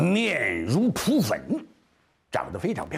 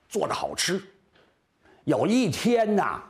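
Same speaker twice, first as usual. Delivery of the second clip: ordinary delivery, nothing marked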